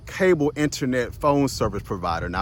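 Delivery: ordinary delivery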